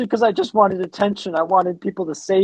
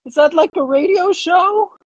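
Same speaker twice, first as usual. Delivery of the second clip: kraken voice; 9 semitones higher, 4 dB louder than usual. Acted. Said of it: "I'm a kraken".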